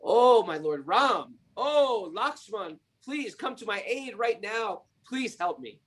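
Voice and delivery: pleading high call to Ram and Lakshman; despairing cry for help. Retold or imitated. Sita calls to Ram in despair, pleading cry. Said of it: "in great despair called out".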